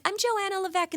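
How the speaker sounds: put-on high, chirpy little-kid voice; high-pitched mock kid voice; imitated child self. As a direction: pipe up like an eager kid introducing herself. Voices herself as a child caller.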